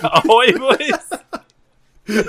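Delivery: high, laughing ahoy call; hearty sailor call. Pretend. Sailor joke greeting.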